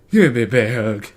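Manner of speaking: lightly voiced sleazy Nick Cage; Nick Cage impression. Nick Cage pulling the nanny close, light creepy put-on voice. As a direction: coax it out like a creepy charmer. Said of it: "my Nick Cage impression".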